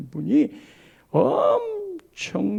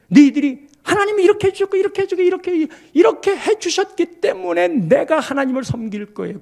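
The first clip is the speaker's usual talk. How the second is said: fast and much higher, listing favours; mock conditional believer; 9 semitones higher, 5 dB louder than usual. Preacher mimics the people's conditional faith, high and fast. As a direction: rattle off the deal like a bargain.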